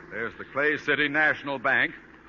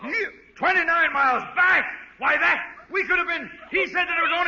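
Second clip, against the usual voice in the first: much higher, louder sputtering rage; furious shouting; 13 semitones higher, 3 dB louder than usual. Acted. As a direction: explode and choke on the words. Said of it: "remember your blood pressure".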